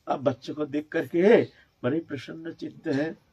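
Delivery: ordinary delivery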